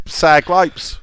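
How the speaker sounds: ordinary delivery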